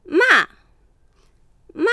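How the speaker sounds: teacher demonstrating ま in falling fourth tone; Mandarin fourth tone. Shown as a demonstration. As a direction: snap ま down from the top. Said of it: "思い切り高いトーンから下へと一気に下げる".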